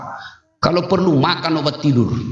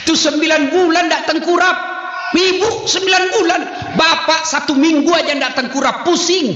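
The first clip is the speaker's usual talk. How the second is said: sharply higher, pushing the point home; preaching pitch rise; 11 semitones higher than usual. Real, not acted. Preacher to wives, voice climbing high. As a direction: raise the pitch to drive it home.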